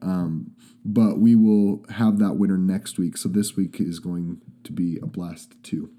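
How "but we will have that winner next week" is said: ordinary talk, nothing marked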